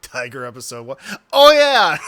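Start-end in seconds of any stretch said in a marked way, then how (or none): 1.3-2.1 loud happy exclamation; excited. Real, not acted. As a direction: burst out with delight. Delighted at the tiger episode.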